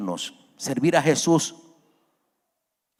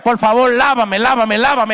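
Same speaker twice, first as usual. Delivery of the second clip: much higher, louder, urgent repeated pleading; desperate begging; 8 semitones higher, 6 dB louder than usual. Acted. Pedro begs Jesus to wash him, urgent loud begging. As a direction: cry out please, wash me, over and over.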